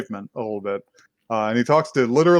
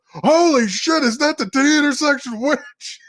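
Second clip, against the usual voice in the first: high startled cry, half laughing; shocked outburst. Retold or imitated. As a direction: blurt it out, stunned. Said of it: "the guy looks at her and says".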